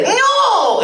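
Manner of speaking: long high-pitched "no" dropping away; exaggerated high, stretched-out cry; imitated outcry. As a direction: draw it out high like a mock wail. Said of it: "is he like".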